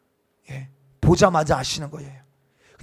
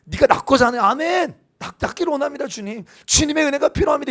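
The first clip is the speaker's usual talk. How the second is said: higher, keen amen to the call; eager answer; 9 semitones higher than usual. Shown as a demonstration. Pastor modeling the right reply to Jesus, eager and higher. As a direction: answer like a grateful believer should. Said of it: "이래야 되는데".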